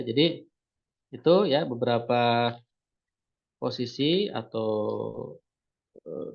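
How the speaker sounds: slow, drowsy speech; nodding off. Real, not acted. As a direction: drag the words out half-asleep. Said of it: "Ini [name] rada ngantuk".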